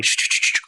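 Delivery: voiceless shh shh whispered into an ear; whispered shushing. Acted. Moses whispering prompts to Aaron, voiceless shushing. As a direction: hiss a secret prompt under your breath. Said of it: "Musa bisik lagi".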